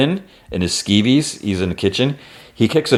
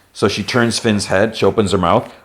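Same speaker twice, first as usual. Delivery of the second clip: usual speaking voice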